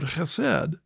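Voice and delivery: chesed said with a throaty opening; the Hebrew word chesed, guttural start. Shown as a demonstration. Host attempting the Hebrew for his co-host, throaty. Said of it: "do his Middle Eastern accent to say that right".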